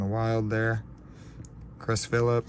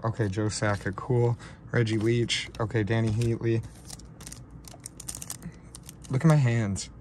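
usual speaking voice

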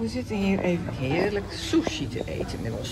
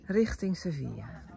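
ordinary talk

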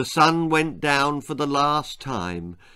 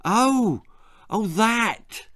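fibbing; 8 semitones higher, much slower than usual. Acted. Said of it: "fibbed Willard".

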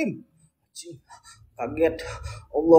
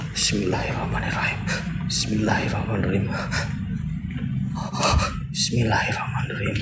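lower, soft whispered bismillah; whispered prayer; 5 semitones lower, 11 dB quieter than usual. Real, not acted. Before opening the suitcase, scared whispered prayer.

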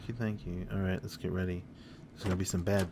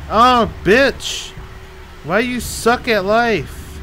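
loud, high, slow yell; yelling after dying in the game; angry yell; 12 semitones higher, 12 dB louder, much slower than usual. Real, not acted. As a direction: bellow it at yourself.

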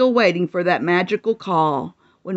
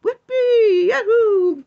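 high, louder whoops; gleeful cheering; 14 semitones higher, 4 dB louder, slower than usual. Acted. Animals partying at the barn, gleeful shouts.

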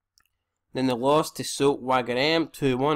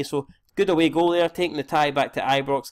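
usual speaking voice

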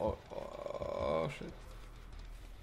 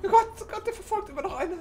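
panicked laughter; 5 dB louder, faster than usual. Real, not acted.

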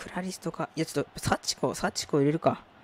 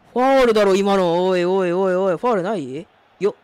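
yelling at the foul, high and loud; outraged shout; 4 semitones higher, 11 dB louder than usual. Real, not acted. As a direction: shout in protest at the ref. Protesting a foul in game.

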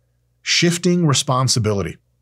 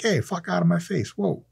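a hint of a brusque New Yorker, quieter; gruff New Yorker; 7 semitones higher, 4 dB quieter than usual. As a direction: brush him off New York style. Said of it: "New Yorkers will be like".

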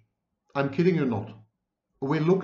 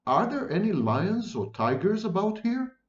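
high-pitched timid question, rising at the end; a touch of timidity, pitched up; 7 semitones higher than usual. A narrator's reading. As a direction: ask timidly, voice lifted. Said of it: "she asked timidly".

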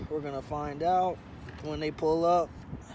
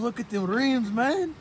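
hyped, louder call to look; excited; 7 semitones higher, 4 dB louder than usual. Real, not acted. Friend hyped about a car's rims, louder. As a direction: point it out all fired up.

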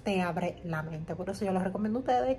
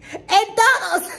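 loud high laughing cry; laughing exclaim; 11 semitones higher, 10 dB louder, much slower than usual. Real, not acted. Telling viewers he's in every video, laughing loud. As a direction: shout it through laughter.

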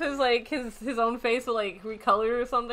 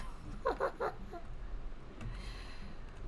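high, soft wheezy giggle; quiet giggling; 10 semitones higher, 14 dB quieter than usual. Real, not acted. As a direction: giggle under the breath. Amused by the costume.

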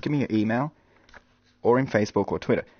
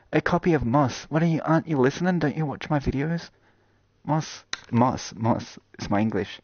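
normal talking voice

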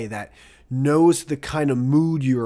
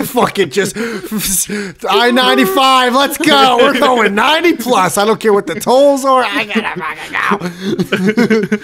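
loud, higher hyped-up driver going all out; reckless driver; 8 semitones higher, 10 dB louder than usual. Pretend. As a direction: hype yourself up to speed down the highway. Acting an angry driver's mood.

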